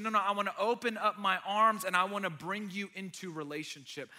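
loud preaching in Jesus' voice. Acted. Pastor voices Jesus inviting people in, loud preaching. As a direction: proclaim it with full conviction.

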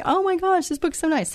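high-pitched imitated gushing; quoted delight. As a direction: act out her pleased reaction, pitch way up. Voicing the helper's delighted reaction.

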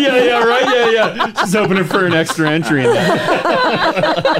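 talking through real laughter; chuckling while teasing about the caller; laughing along. Real, not acted.

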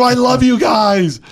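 high, slow, loud superfan cry; over the top gush. Retold or imitated. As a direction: lay it on thick, doubling down. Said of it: "So I go".

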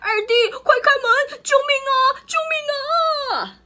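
high, loud yelling for help; panicked shout for help. A narrator's reading. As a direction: scream for your brother to open up. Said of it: "边跑边喊".

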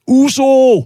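loud, very high held yell; long high-pitched shout to work up nerve; courage shout. Shown as a demonstration. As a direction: holler it like leaping off a cliff into water. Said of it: "hvis jeg råbte".

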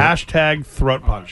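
slow, drawn-out slogan; slow announcer drawl. Acted. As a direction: spell it out slowly like a slogan. Slow emphatic hashtag.

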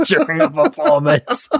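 cracking up mid-story; amused, words breaking into laughter; laughing. Real, not acted. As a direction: say it through high laughter. Laughs while recounting a scandal.

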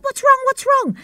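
high, loud alarmed repeat; worried husband. Retold or imitated. Husband calling back from the roof, alarmed and loud. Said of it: "he was like".

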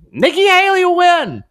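very high imitation of a wishful supporter; mock hopeful voter. Host mimics a voter backing a candidate, very high mock voice. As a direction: pipe up high as a mocked true believer.

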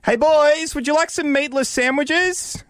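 put-on high, drawn-out call to the kids; put-on high parent voice, slow singsong offer. Parent offering the boys sandwiches, hammed-up calling out.

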